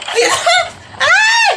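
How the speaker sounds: very high squealing yelp; panicked scream. Acted. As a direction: shriek as the ride takes off. Screaming as the ride starts. (takot na tili)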